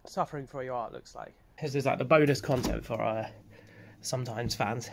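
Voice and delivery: talking weakly after throwing up; soft, drained recovery voice; real exhaustion. Real, not acted. Just vomited, drained.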